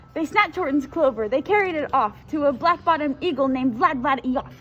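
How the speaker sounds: usual speaking voice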